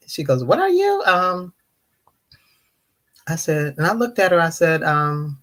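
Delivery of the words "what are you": very high mock question; imitated nosy lady. Lady questioning a stranger, mock nosy. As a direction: mimic her question, pitched way up.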